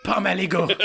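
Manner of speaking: Pomme aligot in a lightly French accent, aligot ending in "go". Shown as a demonstration. Asked by his friend to say the dish the French way, in a light French accent. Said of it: "Can you say it the French way?".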